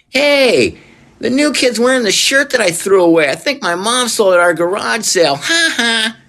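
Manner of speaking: high, taunting kid voice with a mock laugh; mocking schoolkid. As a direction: tease the new kid in front of the class. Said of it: "is some kid saying".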